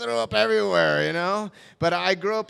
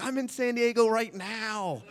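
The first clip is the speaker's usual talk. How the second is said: high, softer, slowed claim; eager fib; 5 semitones higher, 5 dB quieter, slower than usual. Retold or imitated. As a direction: lie sweetly to win a date. Eager lie to his crush.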